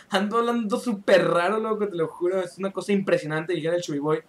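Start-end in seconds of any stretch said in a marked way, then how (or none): none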